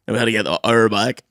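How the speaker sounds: usual speaking voice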